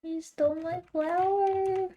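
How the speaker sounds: high, drawn-out wail of protest; playful high-pitched outrage; mock-distressed whine. Real, not acted. Mock outcry at game theft.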